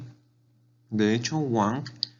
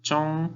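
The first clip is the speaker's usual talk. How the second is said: "Chong" held high and level; high, unbending tone on "Chong"; first tone; 8 semitones higher, 4 dB louder than usual. Shown as a demonstration. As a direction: sustain "Chong" on one high pitch. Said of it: "Primer tono".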